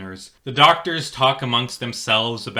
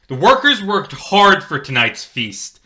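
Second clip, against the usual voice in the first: louder, sharper rebuke; stern scolding; 7 semitones higher, 6 dB louder than usual. Acted. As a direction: scold the boy from above. Cop scolds the boy.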